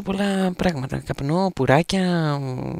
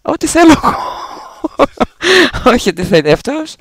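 boisterous, very loud insisting with swooping pitch; loud playful protest; 5 semitones higher, 10 dB louder than usual. Real, not acted. Host insisting on the right song title, loud playful protest. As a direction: argue it at the top of your voice, in fun.

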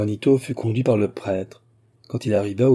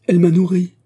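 higher, much louder outcry; anguished cry; 8 semitones higher, 8 dB louder than usual. A narrator's reading. Juanito seeing his mother approach, a loud anguished cry. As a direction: cry it out as she comes near. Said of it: "s'écria-t-il".

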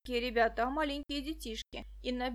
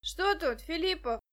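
higher call across; 5 semitones higher, 3 dB louder than usual. A narrator's reading. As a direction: shout the question to a colleague. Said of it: "крикнул один".

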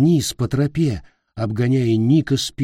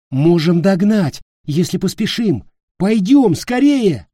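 high, loud, urgent hurry; urgent pleading; 9 semitones higher, 6 dB louder than usual. Acted. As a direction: push him to move now. Nick urges Peter to hurry.

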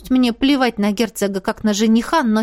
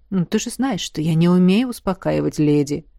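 sighing grandfather; 5 semitones lower than usual. Acted. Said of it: "вздохнул дедушка".

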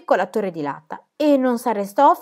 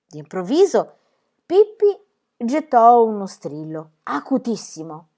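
usual speaking voice

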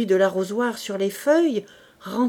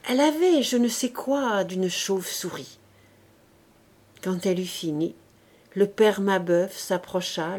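plain reading voice

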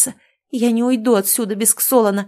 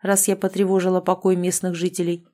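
plain reading voice

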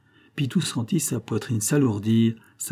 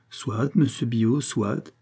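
ordinary delivery

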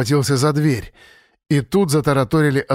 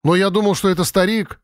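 put-on higher-pitched boyish self-defence; making excuses; 6 semitones higher than usual. A narrator's reading. Zubrilov excusing the thrown stone, defensive. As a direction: explain yourself defensively, pitched up. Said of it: "начал оправдываться Зубрилов".

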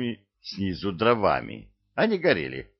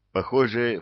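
plain reading voice

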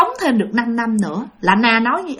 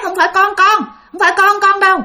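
normal talking voice

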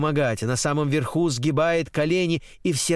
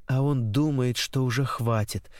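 plain reading voice

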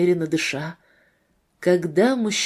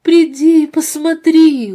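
high, loud, slow entreaty; imploring woman's appeal; pleading; 7 semitones higher, 8 dB louder than usual. A narrator's reading. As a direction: beg him, drawing the words out. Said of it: "умоляла она".

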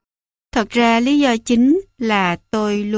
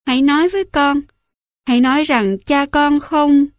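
higher, louder, pleading in dread; desperate plea; 5 semitones higher, 3 dB louder than usual. A narrator's reading. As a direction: beg her to say it isn't so. Son begging mother for news.

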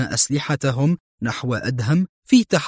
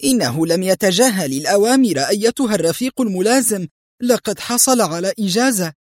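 high, agitated excuse; acted uneasy explanation, pitched up; flustered; 7 semitones higher than usual. A narrator's reading. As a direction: defend him nervously to a superior. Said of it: "غمغمت الحارسة في اضطراب".

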